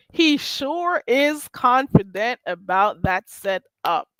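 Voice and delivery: slow, deliberate delivery, word by word; drawn-out emphasis. Real, not acted. Host repeating a remark about his setup, slow and emphatic. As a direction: stretch each word to drive it home.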